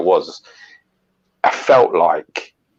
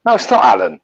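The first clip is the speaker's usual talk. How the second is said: dismissive; 6 semitones higher, 5 dB louder than usual. Retold or imitated. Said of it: "Like, really, like, dismissed".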